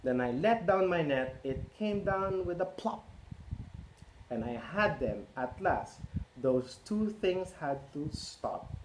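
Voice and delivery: lively picture-book read-aloud; reading to children. A narrator's reading. Parent reading Cat in the Hat to children, lively. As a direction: read it out to a roomful of kids.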